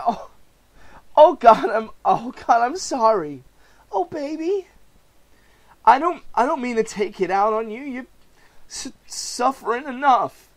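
husband breaking down to his pregnant wife; nervous failing comedian. Acted. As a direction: crack under the worry.

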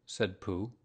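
plain reading voice

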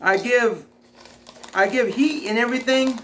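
talking with food in mouth; mid-chew speech; chewing while talking. Real, not acted. Seen 2: Puts chip in mouth at -1, jaw chewing motion during words.

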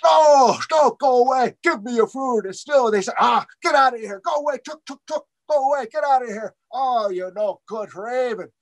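high-pitched dismissive shooing; shooing him off. Acted. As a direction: chase him away with scorn. Spirits shoo Raven away.

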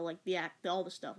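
usual speaking voice

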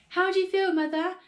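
high, loud worried question; anxious. A narrator's reading. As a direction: check on her fretfully. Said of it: "asked Missus Cranky anxiously".

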